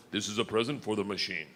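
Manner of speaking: deep-ish Russian conductor; lightly voiced Russian conductor; Russian accent voice.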